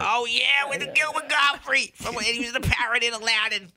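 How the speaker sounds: Gilbert Gottfried voice. Pretend. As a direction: squawk it out like a parrot. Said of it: "you sound like Gilbert Gottfried".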